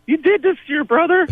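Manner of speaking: very high-pitched mock mother, aghast; scolding mom. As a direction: imitate a mom confronting her kid in a high voice. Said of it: "my mom looks at me".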